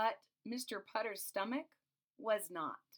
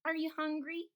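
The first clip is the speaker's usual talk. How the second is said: high, lilting neighborly question; friendly question; 8 semitones higher than usual. A narrator's reading. Neighbor's note asking, lightly voiced. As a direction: ask it like a kind neighbor offering food. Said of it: "The note always said".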